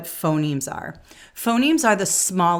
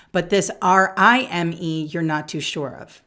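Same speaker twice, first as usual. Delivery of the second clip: ordinary talk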